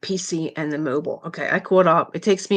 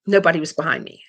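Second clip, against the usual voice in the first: ordinary talk, nothing marked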